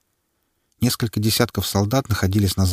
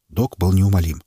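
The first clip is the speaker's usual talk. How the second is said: plain reading voice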